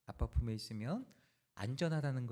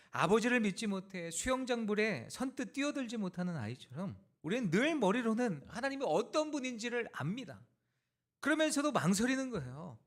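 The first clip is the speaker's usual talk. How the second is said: high, impassioned sermon delivery; pitched-up, emphatic pulpit voice; forceful preaching; 10 semitones higher, 6 dB louder than usual. Acted. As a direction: preach it with rising conviction.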